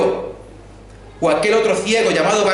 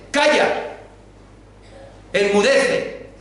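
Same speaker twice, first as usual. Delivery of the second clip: stern rebuke; 4 semitones higher, much slower than usual. Retold or imitated. Jesus commands the wind and sea, stern rebuke. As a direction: rebuke the storm with authority. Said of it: "reprendió a los vientos".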